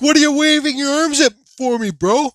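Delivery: high, loud mimicked driver; retold indignant challenge. Other driver challenging him at the truck, retold indignant challenge. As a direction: mimic an aggrieved guy calling him out.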